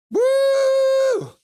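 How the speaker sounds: excited whoop for the chat. Real, not acted. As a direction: let out a big celebratory whoop. Cheering after a viewer's comment.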